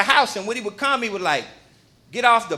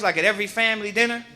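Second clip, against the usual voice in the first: ordinary talk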